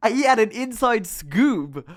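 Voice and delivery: high, loud and slow through laughter; laughing. Real, not acted. Telling viewers about the lecturer, laughing. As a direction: giggle the words out.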